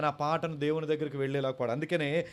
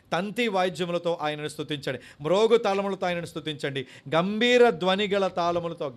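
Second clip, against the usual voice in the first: solemn scripture reading; 5 dB louder than usual. Acted. Reads psalm aloud.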